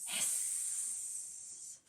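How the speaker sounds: drawn-out voiceless hiss of a fire going out; quiet hissing noise; hissing fire sound. Shown as a demonstration. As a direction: let the hiss fade like doused flames. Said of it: "it sounded like this".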